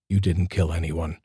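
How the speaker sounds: usual speaking voice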